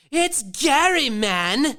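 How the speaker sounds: high mock voice of a slacker friend; imitating Gary. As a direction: lightly put on the friend's drawl. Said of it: "And he goes".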